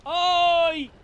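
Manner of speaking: sharp pained yelp. Real, not acted. Sudden hit in game.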